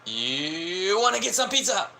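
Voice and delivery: high, hammed-up put-on voice; mock Japanese American. Imagining Americans talking like that, high put-on voice. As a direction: ask about pizza in a squeaky fake accent. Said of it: "they'd be like".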